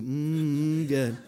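low, drawn-out tasty hum; soup-ad savoring. Retold or imitated. Pastor likens the verse to soup, savoring it. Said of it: "Like Campbell's soup".

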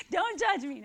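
laughing it off, embarrassed. Real, not acted. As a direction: laugh through a sheepish plea.

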